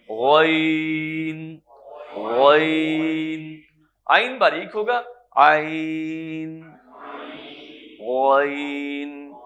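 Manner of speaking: "غین" full-mouthed and heavy, "عین" thin, each held long; slow heavy-versus-thin letter demo; thick vs thin letters. Shown as a demonstration. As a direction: contrast a heavy "غین" with a fine "عین", slowly. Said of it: "بلکہ منہ بھر کے".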